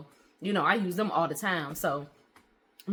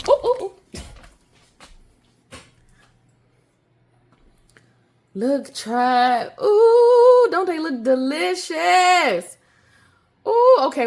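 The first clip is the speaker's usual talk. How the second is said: high, loud delighted oohs; excited squeals; 10 semitones higher, 10 dB louder, slower than usual. Real, not acted. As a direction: squeal with delight over the cookies. Cookies fresh out of oven.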